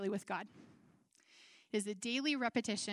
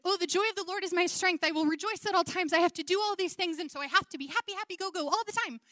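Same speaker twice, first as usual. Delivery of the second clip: higher, louder, fast mock cheerfulness; forced cheer; 5 semitones higher, 4 dB louder, faster than usual. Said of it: "We feel like".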